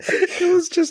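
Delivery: high, loud words squeezed out by laughter; laughing. Real, not acted. Co-host cracking up at the filing story, laughing. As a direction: squeal it through helpless laughter.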